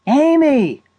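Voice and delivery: high-pitched calling out; yelled name. A narrator's reading. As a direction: yell for a companion at the end of patience. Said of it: "Sarah yelled".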